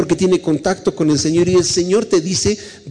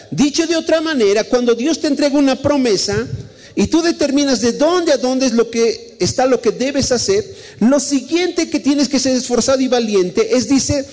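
high-pitched, insistent preacher; preaching exhortation; 10 semitones higher than usual. Real, not acted. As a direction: drive each repeated command home, pitch held high. Sermon exhortation builds through repetition. (prédica exhortativa, aguda e insistente)

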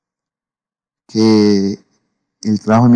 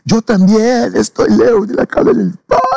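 put-on high tearful sob-voice, retelling the boy's words; crying; 10 semitones higher than usual. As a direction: choke the line out through tears. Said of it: "Y él empezó a llorar". (llorando con la voz quebrada)

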